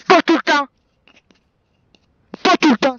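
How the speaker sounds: loud exasperated shout; angry shout. Real, not acted. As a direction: shout it in frustration.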